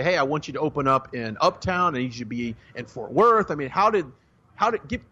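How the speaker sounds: normal talking voice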